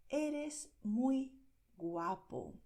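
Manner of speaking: Eres muy guapo very slow with muy stressed; slow careful. Shown as a demonstration. Teacher modelling a compliment slowly. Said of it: "Eso es lo que se dice".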